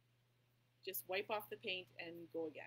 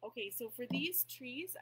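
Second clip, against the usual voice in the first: louder and slower, projecting to be heard; talking super loud; 4 semitones higher, 7 dB louder, slower than usual. Real, not acted. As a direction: push the voice out so the back row hears. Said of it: "I'll try and talk super loud".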